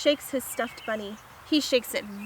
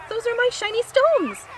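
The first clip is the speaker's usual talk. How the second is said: very high, loud protest; indignant small dog; 13 semitones higher, 5 dB louder than usual. Acted. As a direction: squeal a complaint as a tiny dog. Fifi protests to Maurice.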